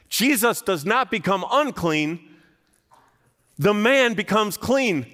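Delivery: loud, high preaching at full force. Real, not acted. Pastor preaching to congregation, loud and high. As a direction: drive the point home to the church. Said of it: "preaching at full force".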